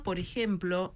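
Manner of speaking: ordinary talk, nothing marked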